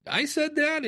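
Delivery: high, incredulous retold question; surprised. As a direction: sound amazed at your own old words. Surprised at own old quote.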